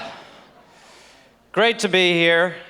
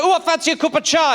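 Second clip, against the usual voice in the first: higher-pitched British mum impression; English mother's accent; 7 semitones higher than usual. Comedian mimicking his mother wanting tea, high British accent. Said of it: "She'd be like".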